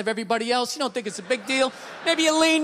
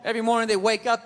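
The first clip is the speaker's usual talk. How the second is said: normal talking voice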